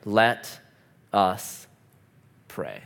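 low, soft and slow, bowing into prayer; hushed, solemn call to pray; kneeling slave calls the others to prayer, solemn and low. A narrator's reading. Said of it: "saying in a solemn voice".

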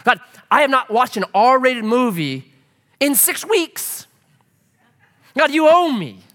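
high, loud demanding voice; hammed-up demand on God; mock demanding.

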